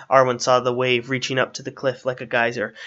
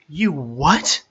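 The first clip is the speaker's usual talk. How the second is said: higher, astonished question; incredulous; 4 semitones higher, 3 dB louder than usual. A narrator's reading. Arwen hears Strider brought down the cliff, incredulous. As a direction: question it in disbelief.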